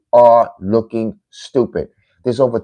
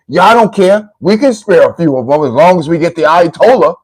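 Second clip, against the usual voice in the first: mocking the fans; 5 semitones higher, 6 dB louder than usual. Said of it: "That's how they think".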